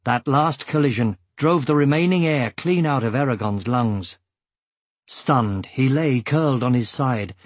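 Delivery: ordinary delivery, nothing marked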